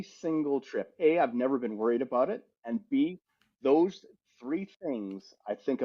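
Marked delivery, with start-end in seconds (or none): none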